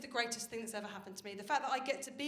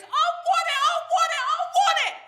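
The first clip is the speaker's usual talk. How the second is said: put-on much higher, louder, stretched toddler whine; toddler tantrum wail; 13 dB louder than usual. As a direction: wail it like a furious three-year-old.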